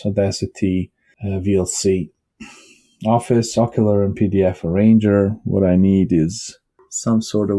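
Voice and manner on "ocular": usual speaking voice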